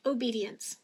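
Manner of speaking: ordinary delivery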